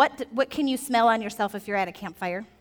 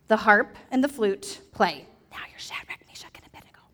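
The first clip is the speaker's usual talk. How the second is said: unvoiced whisper to the kids. Shown as a demonstration. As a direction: hush it like a secret cue. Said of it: "whispered stage direction".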